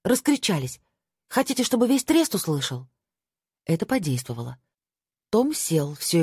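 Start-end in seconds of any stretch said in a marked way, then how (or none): 0.0-2.9 high-pitched, exasperated rebuke; scolding. A narrator's reading. Friend silencing the quarrelling group, scolding. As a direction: tell them off for shouting.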